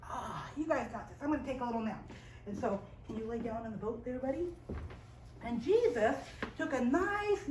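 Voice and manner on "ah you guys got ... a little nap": soft, low, relaxed sigh into words; easygoing low voice, winding down; sleepy, settling down. Acted. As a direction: murmur it casually, ready for a nap. Voicing Jesus about to nap.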